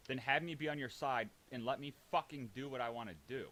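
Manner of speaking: usual speaking voice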